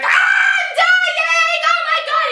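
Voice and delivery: very high, drawn-out wail of pain; hammed-up screaming in agony; mock agonized shriek. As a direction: shriek as if your hand just got smashed. Acting out hand hit while catching.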